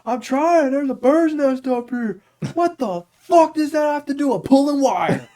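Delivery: very high, loud exasperated complaint; 1 mock frustrated coworker. Quoting an annoyed coworker at work, high exasperated rant. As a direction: whine it way up high and fed up.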